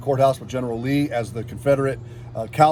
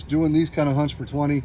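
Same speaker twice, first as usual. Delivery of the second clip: ordinary talk, nothing marked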